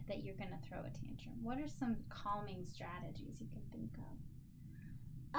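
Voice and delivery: soft unvoiced whisper. Real, not acted. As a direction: drop to a hush for the question. Teacher drops to whisper.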